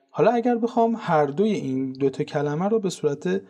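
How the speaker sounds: ordinary delivery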